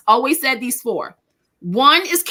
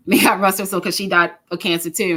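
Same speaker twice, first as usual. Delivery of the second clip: usual speaking voice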